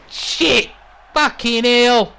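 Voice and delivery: sudden high, loud burst of alarm; shocked exclamation. Acted. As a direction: react aghast to a brutal slam. Commentator reacts to a hard slam.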